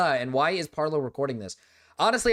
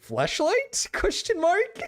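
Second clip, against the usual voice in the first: laughing; 13 semitones higher, much slower than usual. Real, not acted. Laughing at the flashlight joke.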